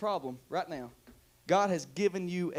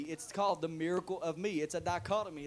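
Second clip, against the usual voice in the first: ordinary delivery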